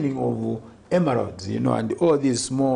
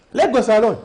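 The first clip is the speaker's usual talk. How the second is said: laughing; 8 semitones higher, 6 dB louder, faster than usual. Real, not acted. Laughing echo of the quote.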